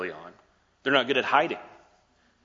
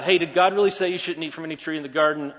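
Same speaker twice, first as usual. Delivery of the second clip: a touch louder, breezy and offhand; casual snake; 6 semitones higher, 6 dB louder than usual. Acted. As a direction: play the snake like a laid-back buddy. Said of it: "my snake was so casual".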